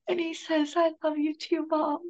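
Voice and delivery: high, tearful recollection. Real, not acted. Bereaved mother recalling her son's answer, tearful. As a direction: quote him through real, held-back tears.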